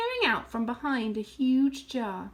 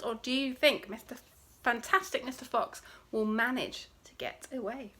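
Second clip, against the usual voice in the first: plain reading voice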